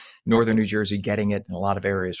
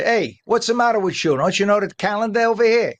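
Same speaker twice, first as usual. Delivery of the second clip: put-on a much higher, lightly accented scolding of Mother Nature; 10 semitones higher, 4 dB louder than usual. Anchor scolding Mother Nature on the phone, in a put-on accent. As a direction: scold her in a hammed-up foreign accent. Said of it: "you have to use that accent".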